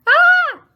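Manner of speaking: very high loud mock scream ah. Shown as a demonstration. Teacher screams ah for the class. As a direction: let out a playful scream.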